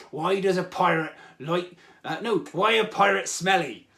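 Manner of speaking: hammy pirate with a drawn-out "arr"; terrible pirate accent. Pretend. Host telling a pirate joke, terrible pirate accent. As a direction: draw the punchline out like a stage pirate. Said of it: "I'm gonna be doing my terrible pirate accent".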